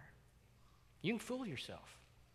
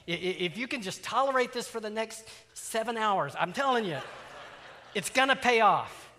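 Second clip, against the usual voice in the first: louder coaxing sales pitch; bribing sweet-talk; 4 semitones higher, 6 dB louder than usual. Retold or imitated. Dad bribing his daughter with ice cream, coaxing. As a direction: dangle the treat like a bribe. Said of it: "we move into the bribery stage".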